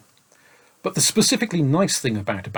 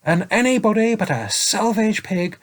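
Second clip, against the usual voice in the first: high, slow stern scolding; scolding saint; 10 semitones higher, 5 dB louder, slower than usual. A narrator's reading. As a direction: scold the selfish dragon.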